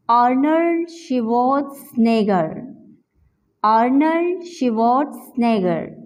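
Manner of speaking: आर्नल्ड शिवार्ट्जनेगर said slowly, each part clear; careful pronunciation. Shown as a demonstration. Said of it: "इसको हम pronounce से भी किस तरह से करेंगे". (आर्नल्ड शिवार्ट्जनेगर का धीमा, साफ़ उच्चारण)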